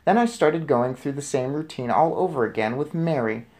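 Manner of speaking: ordinary delivery, nothing marked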